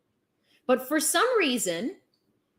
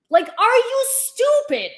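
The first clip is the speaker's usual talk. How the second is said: exasperated; 8 semitones higher, 6 dB louder than usual. Real, not acted. Exasperated rant at the viewer.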